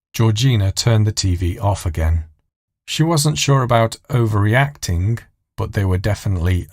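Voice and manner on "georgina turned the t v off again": ordinary delivery, nothing marked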